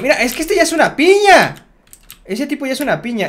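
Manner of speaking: excited praise. Real, not acted. Judging another player's build.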